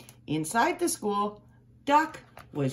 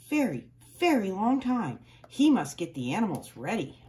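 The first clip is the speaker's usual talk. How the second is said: usual speaking voice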